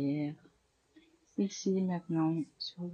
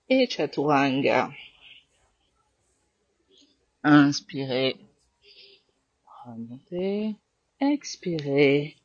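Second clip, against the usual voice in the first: firm, clear cues over the breath; yoga class cueing; 7 dB louder than usual. Acted. Yoga teacher guiding students through sun salutations, firm cues. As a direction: call out each pose and breath to the class.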